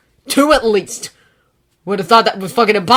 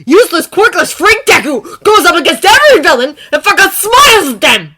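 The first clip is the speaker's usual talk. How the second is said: much louder, higher raging tirade; raging shout; 12 semitones higher, 16 dB louder than usual. Acted. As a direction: rage louder to the end. Bakugo's ranting accusation.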